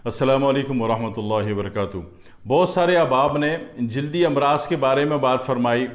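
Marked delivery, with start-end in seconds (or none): 0.1-2.0 measured, lower recitation of the opening blessing; formal religious invocation. Acted. Preacher opening his talk with an Arabic invocation, solemn recitation. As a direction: intone it like a preacher opening a sermon.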